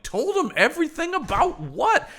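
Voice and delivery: very high-pitched mock disbelief; incredulous echo. Hearing Elizabeth told him everything, high-pitched incredulity. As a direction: throw it back as a baffled question.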